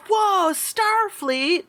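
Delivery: put-on high, drawn-out wowed kid voice; stretched-out childlike amazement; awestruck kid. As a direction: marvel like a starstruck child. Game master acts awestruck kids.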